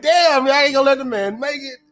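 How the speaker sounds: high-pitched, incredulous mock outburst; mock protest. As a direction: call out the doubters in exaggerated disbelief. Mock reply to nervous passengers.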